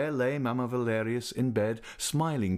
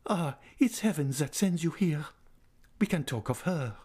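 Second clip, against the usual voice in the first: put-on old lady's voice; 7 semitones higher than usual. A narrator's reading. Mamma Valerius welcomes Raoul.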